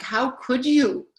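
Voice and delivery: indignant. Shown as a demonstration. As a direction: draw out wounded indignation. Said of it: "this indignation too, like".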